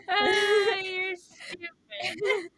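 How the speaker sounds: high, slow, laughing squeal; cracking up for real; helpless laughter. Real, not acted. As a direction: squeeze the words out through laughter. Cracking up at the other's explanation.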